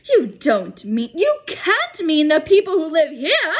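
loud, high appalled cry; high, loud, appalled outburst. Acted. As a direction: cry out in horror. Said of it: "cried Professor McGonagall".

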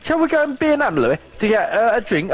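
mock high, quick girlfriend voice; mimicking Catherine. Retelling Catherine inviting him to the shop. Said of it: "she said".

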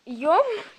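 loud laugh at her own slip; embarrassed laugh. Real, not acted. Girl laughing after tripping over a word. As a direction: laugh off the tongue-twist.

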